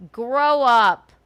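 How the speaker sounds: loud sharp scold. Real, not acted. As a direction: bark it at them, fed up. Scolding a rival publicly.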